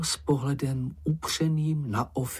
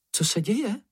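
high, jumpy, frightened question; startled yelp; 10 semitones higher than usual. A narrator's reading. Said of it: "vyjekla slečna Ferka". (vysoce a polekaně vyjeknout)

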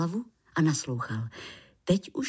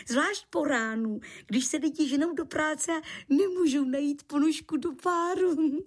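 high, talkative creature voice; chatty little creature; 8 semitones higher than usual. Acted. Lichožrout tells landlord how people usually curse them, high chatty voice. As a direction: confide at length in a small high voice.

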